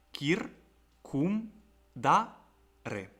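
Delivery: "kir, cum, da, re" split with clear pauses; "kir, cum, da, re" as four separate syllables. Shown as a demonstration. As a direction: sound out "kir, cum, da, re" one beat each. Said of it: "composed by four syllables".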